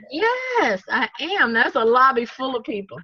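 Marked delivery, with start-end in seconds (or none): 0.1-1.5 high, eager yes. Retold or imitated. Relieved guest answers the shuttle driver eagerly, high. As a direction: jump at the offer.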